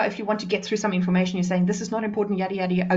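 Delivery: fast, throwaway skimming over unimportant information; sped-up delivery. Shown as a demonstration. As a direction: rattle through it quickly. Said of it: "to sometimes speed it up".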